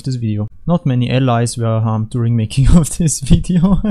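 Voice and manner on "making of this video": breaking into a laugh mid-sentence; chuckling through the words. Real, not acted. Repeats disclaimer, laughs mid-line.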